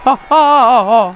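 high loud burst of laughter; laughing. Real, not acted. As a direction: break out laughing. Laughing at the game.

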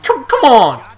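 loud, high, exasperated yell of come on; frustrated shout. Real, not acted. Gamer shouting at his fumbling player, exasperated yell. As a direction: yell at the game in real frustration.

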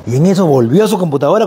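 ordinary talk, nothing marked

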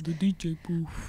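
hushed tease, no voice at all; whisper. Real, not acted. Friend teasing about the DJ booth, whispered. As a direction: breathe it out like a secret.

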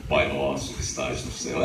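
chest puffed, very low solemn reading; deep reading voice. Retold or imitated. Teen reads a psalm at church, very low solemn voice. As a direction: read the psalm in a booming low voice. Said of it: "peito de pombo".

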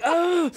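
high startled cry of pain; sharp pained yelp. Retold or imitated. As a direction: yelp as if stung. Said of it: "I'm like".

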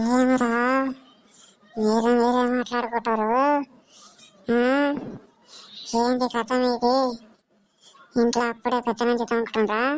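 ghostly, stretched-out scolding; feigned possession. Pretend. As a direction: drag out the line endings like a possessed woman. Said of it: "దయ్యం పట్టిందని నాకు అట్లా చేస్తున్నావు?".

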